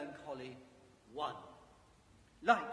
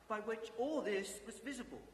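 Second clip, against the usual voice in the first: plain reading voice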